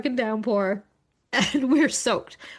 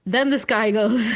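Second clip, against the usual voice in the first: normal talking voice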